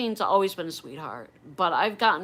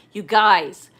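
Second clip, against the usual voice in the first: guys much louder, falling; excited call-out; 12 dB louder than usual. Real, not acted. Vlogger hyping viewers about stickers, loud and excited. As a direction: grab viewers' attention loudly.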